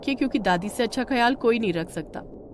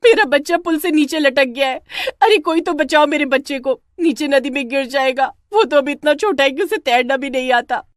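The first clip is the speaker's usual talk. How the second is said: frantic high cry for help; loud, desperate alarm; panicked mother shouting; 7 semitones higher, 5 dB louder than usual. Acted. Mother sees child dangling, screams.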